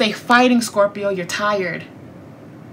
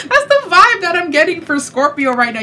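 laughing; 7 semitones higher than usual. Real, not acted. Laughs through the line.

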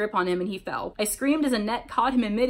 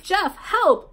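high, loud cry of alarm; yell for help; 10 semitones higher, 5 dB louder than usual. A narrator's reading. Lou ensnared, calling Jeff, alarmed yell. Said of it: "Lou yelled".

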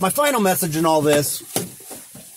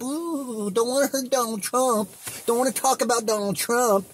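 higher, much softer prissy voice; mock pearl-clutching; 4 semitones higher, 5 dB quieter than usual. Vlogger mocks fans protecting Trump, high and soft. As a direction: tease offended viewers with a dainty gasp.

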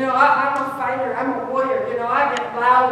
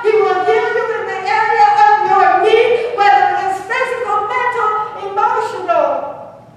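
loud, higher, fervent sermon cry; preacher prophesying healing to the viewers, loud; preaching at full force; 7 semitones higher, 7 dB louder than usual. Acted. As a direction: pour it out like a revival.

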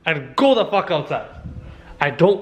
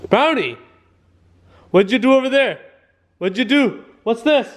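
higher, raised voice telling off a dog; scolding; 7 semitones higher, 4 dB louder than usual. Real, not acted. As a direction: confront the culprit, voice pitched up. Scolding dog over pee.

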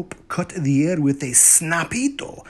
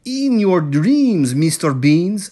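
scornful retort; 3 semitones higher, 7 dB louder than usual. A narrator's reading. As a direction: shoot down the cheeky kid, loudly. Mama retorts to defiant kitten.

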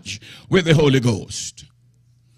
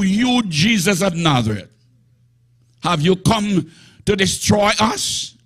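possessed man defying Jesus, raised and higher; demon speaking; 5 semitones higher than usual. Acted. The devil talking in church, defiant and raised. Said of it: "this is the devil talking in church".

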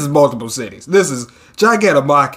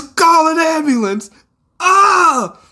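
frantic call; 13 semitones higher, slower than usual. Acted. Rescuer shouting for an ambulance, high frantic shout. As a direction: shout for help in panic.

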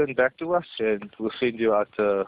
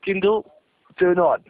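louder, higher voice command; repeating louder and sillier; 7 semitones higher, 6 dB louder than usual. Acted. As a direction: call it out loud to the device. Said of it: "One more time, louder".

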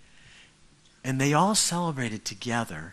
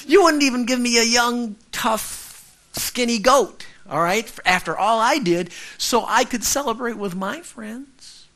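resentful son; 12 semitones higher, 8 dB louder than usual. Acted. Older brother's complaint, paraphrased.

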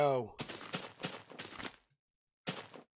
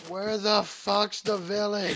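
exasperated yell; 6 semitones higher, 6 dB louder than usual. Real, not acted. Lost, exasperated yelling.

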